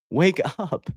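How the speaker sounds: ordinary delivery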